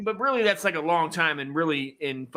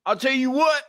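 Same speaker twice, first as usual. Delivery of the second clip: put-on country accent; 7 semitones higher than usual. Co-host inviting liberals down to his farm, hammed-up country accent. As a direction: ham up a good ol' boy Southern accent. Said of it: "you should talk like that".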